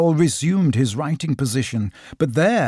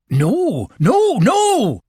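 high, louder run of refusals; impatient; 11 semitones higher, 4 dB louder, much slower than usual. A narrator's reading. Rabbit objecting to Christopher Robin, impatient. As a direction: cut them off impatiently, voice leaping up. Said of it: "said Rabbit impatiently".

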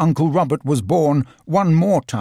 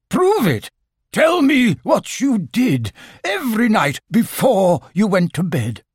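raised pitch, settling by the end; challenging; 8 semitones higher than usual. A narrator's reading. Owl testing the supposed uncle's ghost, challenging. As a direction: demand proof, starting high and insistent.

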